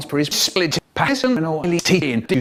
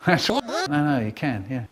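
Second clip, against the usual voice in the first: ordinary delivery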